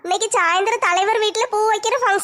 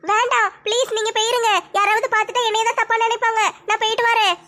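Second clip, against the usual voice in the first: ordinary talk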